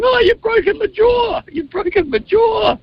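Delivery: very high, slow imitated wail; drawn-out mocking high cry. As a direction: wail like a hurt man, hammed up. Acts out injured man running outside.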